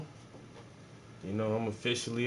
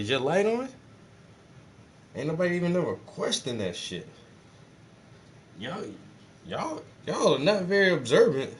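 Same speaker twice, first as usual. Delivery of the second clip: exasperated scolding; 7 semitones higher than usual. Real, not acted. Scolding friends for not noticing the light was off, exasperated rant. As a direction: call your friends out, pitched up and indignant.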